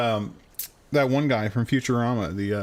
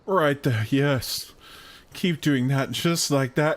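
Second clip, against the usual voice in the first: milk every moment of the massage; hammed-up pleasure; 3 semitones higher, much slower than usual. Companion urges the massage on, hammed-up moaning.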